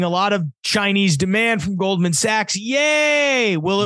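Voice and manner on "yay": high, drawn-out fake "yay"; mock cheer. Co-host doubting the bank's oil demand forecast, mock cheer. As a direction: cheer it with put-on glee.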